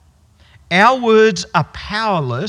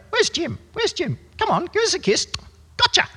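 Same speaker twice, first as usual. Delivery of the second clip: much higher, chattering bird voice stringing phrases; budgie voice; 13 semitones higher than usual. Retold or imitated. Budgie mixing its phrases together, squawky bird voice. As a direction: rattle it off like a parrot. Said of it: "he'd sort of string it all together".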